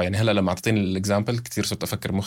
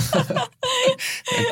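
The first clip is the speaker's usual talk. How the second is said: quick high-pitched laugh; high burst of laughter. Real, not acted. Laughs at guest jumping ahead.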